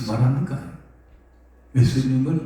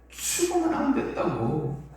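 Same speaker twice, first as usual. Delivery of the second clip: pleading believer; 9 semitones higher than usual. Retold or imitated. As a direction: beg him not to die. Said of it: "이거거든요".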